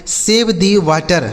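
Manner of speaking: each word said very slowly and clearly; slow clear English. Shown as a demonstration. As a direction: dictate it slowly for learners. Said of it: "जैसे बोल सकते हैं". (बहुत धीरे, साफ़ अंग्रेज़ी उच्चारण)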